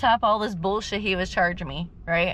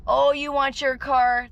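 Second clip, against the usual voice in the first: slow, higher, louder sneering imitation; snide mock tow guy; 7 semitones higher, 8 dB louder, slower than usual. Mimicking the smug tow guy to the viewers, slow and sneering. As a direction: drag it out like a smug tow man. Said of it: "And he's like".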